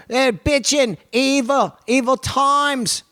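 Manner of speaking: high, drawn-out British rocker voice; mock British. Joking through a pedal sponsor read, high mock British voice. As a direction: relish it in a high put-on British accent. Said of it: "If you're British".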